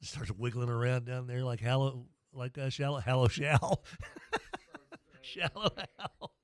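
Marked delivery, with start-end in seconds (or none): 3.9-4.9 giggle. Real, not acted. Cracking up at own joke. 5.2-6.3 giggled through the words; laughing. Real, not acted. Host cracks up recalling the movie, laughing.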